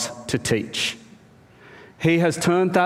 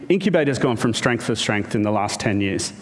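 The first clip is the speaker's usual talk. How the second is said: ordinary talk, nothing marked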